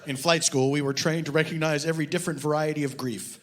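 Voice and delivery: deadpan reading of a mock detective novel; story reading. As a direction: read it dry, like a serious audiobook. Author reads his novel aloud.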